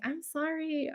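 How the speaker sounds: small, high, soft sorry; mock apology. Retelling reply to sister, mock meek apology. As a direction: apologize in a put-on meek little voice.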